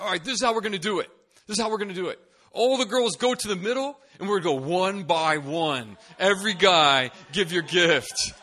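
high, loud, hyped-up announcing; rally the whole room; banquet emcee. Retold or imitated. Banquet host announcing gift round, loud and hyped.

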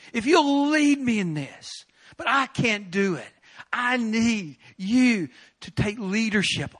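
fervent slow prayer rising in pitch; crying out to God. Retold or imitated. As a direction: beg God to take over. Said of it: "I began calling out to God".

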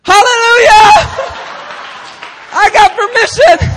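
very high, very loud triumphant yell; joyful shout. Retold or imitated. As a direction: cheer like you just won. Retold joyful celebration.

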